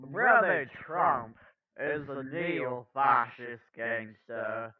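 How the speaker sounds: joyful, measured preacherly lilt; easygoing orator impression, words drawn out; Cornel West. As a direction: savor it slowly with a little joyful spin. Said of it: "put a little joyful spin on it".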